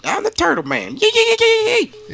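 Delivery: very high-pitched silly squeal; squeaky turtle man. Acted. Comic chiming in with a very high squeaky bit. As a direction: squeak it out like a cartoon.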